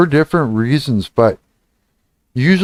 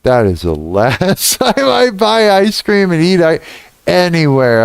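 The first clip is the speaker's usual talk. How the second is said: laughing disgust; 6 semitones higher than usual. Retold or imitated. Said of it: "And I was just like".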